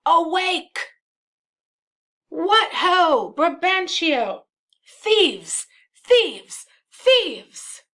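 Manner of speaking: high, loud alarm cry; urgent shouts, each falling away; yelling. Acted. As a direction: yell to wake the household. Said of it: "Iago then yells".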